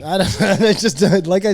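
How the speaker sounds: high, loud laugh breaking through the words; giggling nervous dodge. Real, not acted. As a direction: laugh it off while trying to speak. Laughing off the topic.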